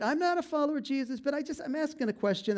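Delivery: high-pitched, hurried disclaimer as Nicodemus; timid objection. Retold or imitated. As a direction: voice a cautious objector, pitched well up. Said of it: "He spoke up a little bit".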